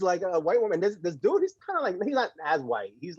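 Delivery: ordinary delivery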